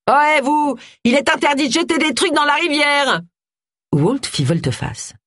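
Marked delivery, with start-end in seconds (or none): 0.1-3.2 high, carrying shout across the bridge; calling out. A narrator's reading. Dog walker yelling at Walt from the bridge, a high call. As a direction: call out to stop him, much higher.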